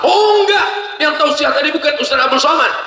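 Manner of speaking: excited denial. Acted. Joking denial to the crowd.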